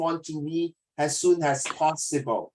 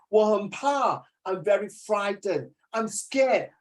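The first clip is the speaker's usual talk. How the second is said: higher, slow, mock-frightened voice; demo fright; 5 semitones higher, slower than usual. Shown as a demonstration. Teacher showing a student how to sound frightened. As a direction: act a little scared. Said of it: "you must bring out the moods".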